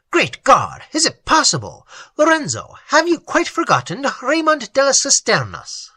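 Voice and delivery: a hint of amazed recognition; astonished nobleman.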